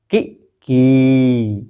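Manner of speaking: कि short, की long and held; की stretched from the palate; short and long i demo. Shown as a demonstration. Teacher contrasts the vowels for pupils, short then long. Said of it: "तालु".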